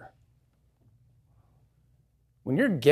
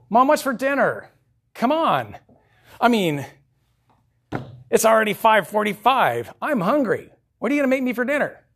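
put-on higher and louder, a pushy kid nagging mom; whiny demanding child; 7 semitones higher, 5 dB louder than usual. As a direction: play a spoiled kid demanding dinner, fast at the end. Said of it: "This is a proud child. I'll give you an example".

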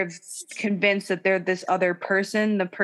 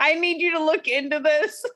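mimicking [name]; 7 semitones higher than usual. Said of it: "He, like, tags them".

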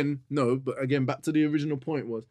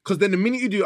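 usual speaking voice

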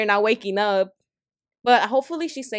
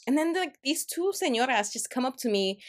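normal talking voice

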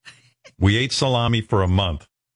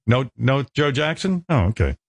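usual speaking voice

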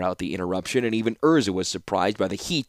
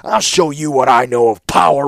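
loud, higher, heated threat; threatening reply; 4 semitones higher, 7 dB louder than usual. A narrator's reading. Warlord answering the young ruler's boast of power, menacing. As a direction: threaten him as if calling his bluff. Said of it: "replied the warlord".

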